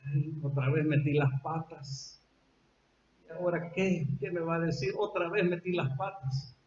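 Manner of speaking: soft, downcast murmur; Pedro's soft lament. Retold or imitated. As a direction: mutter it to yourself, ashamed. Pedro laments his failure.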